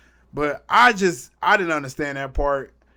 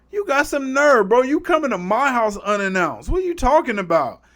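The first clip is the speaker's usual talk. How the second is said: raised-pitch mock indignation; affronted comeback; 7 semitones higher, 4 dB louder than usual. [name] retorting to Peter in his house, mock affronted. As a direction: imitate an offended man telling someone off.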